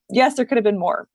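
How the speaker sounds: ordinary talk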